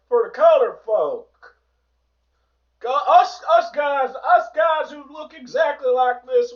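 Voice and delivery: high, loud caricature of an entitled bigot; loud, hammed-up parody of a political opponent; mocking bigot voice. Mock quote of the pushed views.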